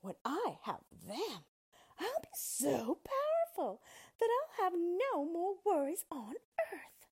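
high witch voice, swelling with power; rising, greedy witch's gloat; scheming witch. A narrator's reading. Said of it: "the witch said to herself".

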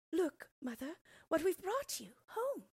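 put-on very high, eager child voices; excited children. A narrator's reading. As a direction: call out proudly like children. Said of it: "cried the children".

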